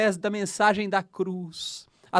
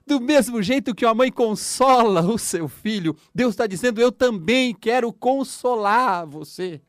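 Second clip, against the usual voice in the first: loud, higher fervent preaching; preaching at full force; 4 semitones higher, 6 dB louder than usual. Acted. Preacher urging listeners to accept God's comfort, fervent and loud. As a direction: urge them to open their hearts.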